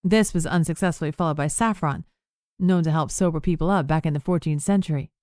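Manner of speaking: ordinary delivery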